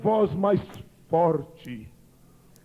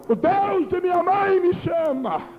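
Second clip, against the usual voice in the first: mock cry out; 6 semitones higher, 3 dB louder than usual.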